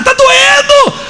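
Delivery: very high mock wail of pain; crying in pain. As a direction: imitate a woman sobbing that it hurts. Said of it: "Começou a chorar". (imitando choro de dor, agudo)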